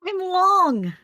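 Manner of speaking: high, loud, drawn-out reply; excited announcement. Acted. Changed creature answers a shocked friend, high and excited. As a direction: sing out the news, high and loud.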